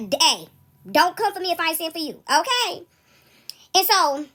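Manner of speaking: usual speaking voice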